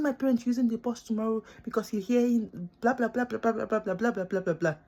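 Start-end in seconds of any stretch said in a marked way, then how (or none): none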